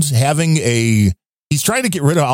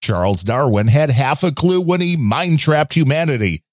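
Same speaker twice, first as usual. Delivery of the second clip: ordinary talk, nothing marked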